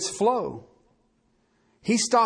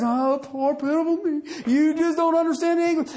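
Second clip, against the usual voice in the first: mock self-pity; 6 semitones higher than usual. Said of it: "like the beginning of the psalm".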